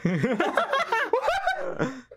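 long high-pitched laughing fit; squealing laugh. Real, not acted. Laughing after shut up.

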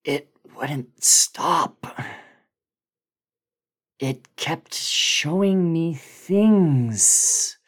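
high, slow, strained voice with whispered breaks; a shaken man reliving something he can't explain; haunted Bill. Acted.

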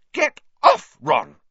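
very high, loud snap; angry shout. A narrator's reading. Hermione shaking off Ron's grabbing hand, furious. Hermione shakes Ron off angrily.

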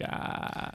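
creaky vocal fry sound; vocal fry demo. Shown as a demonstration. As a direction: creak on a soft ah. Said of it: "vocal fry is when they go like".

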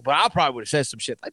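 ordinary delivery

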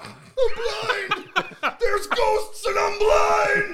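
shrieking high mock wail; hysterical mock wail. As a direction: wail it like a panicked actor. Mocking blind Zach, shouting.

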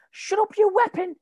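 high, loud comeback in a mock snarl; snapping back. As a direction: retort like he's been insulted. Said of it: "he just turned around and shot back".

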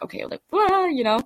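high-pitched scared babble; mock scared. Scared around strangers, a high mock-panicked blurt. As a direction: act briefly flustered, pitch leaping up. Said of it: "we just get scared".

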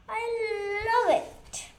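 a happy cry of love for the food; delighted. A narrator's reading. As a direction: savour every bite aloud. Beetle enjoying food.

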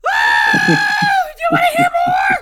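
high, loud frantic shouting. Retold or imitated. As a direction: yell it out in a high voice. Retelling a ranting man.

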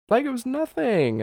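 very high, squeaky mock voice; playful high-pitched echo; exaggerated repeat. Shown as a demonstration. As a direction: push the phrase way up high for comic effect.

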